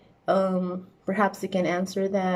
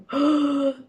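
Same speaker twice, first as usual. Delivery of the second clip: sharp gasp of delight, higher; relived thrilled intake of breath; excited gasp; 7 semitones higher, 4 dB louder than usual. Retold or imitated. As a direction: gasp as if hearing good news again. Recalling hearing she passed.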